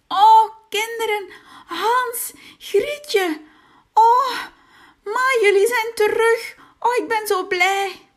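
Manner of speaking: high, loud joyful cry; a father overjoyed to see his children back. A narrator's reading. (dolblije vader, hoog en luid)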